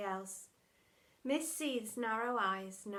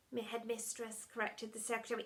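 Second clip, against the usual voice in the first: ordinary delivery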